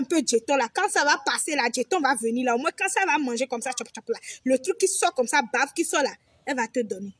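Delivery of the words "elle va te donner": ordinary talk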